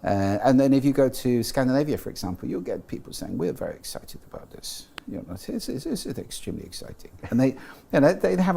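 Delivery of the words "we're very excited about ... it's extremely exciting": quiet, flat Scandinavian-style sing-song, barely moving; Scandinavian flat prosody. Retold or imitated. To the host, imitating Scandinavians: restrained, flat, quiet cadence. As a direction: mimic a restrained Scandinavian cadence, soft and even. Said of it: "a much more restrained prosody".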